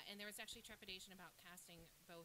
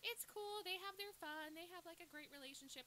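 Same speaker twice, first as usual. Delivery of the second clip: a hint of an easygoing dad, pitched up; lightly voiced breezy dad; 11 semitones higher than usual. Dad excusing a girlfriend's cheating, breezily.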